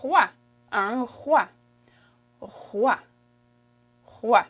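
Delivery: roi said as rwa with a throat R; French pronunciation. Shown as a demonstration. Teacher shows king in French, careful isolated roi. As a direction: repeat roi crisply, spaced for learners. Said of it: "King is".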